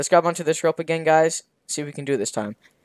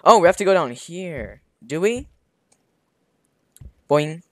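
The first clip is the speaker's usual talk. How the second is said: ordinary delivery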